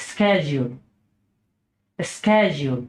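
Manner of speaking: American schedule as SKED-jool; American pronunciation. Shown as a demonstration. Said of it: "American উচ্চারণ হচ্ছে".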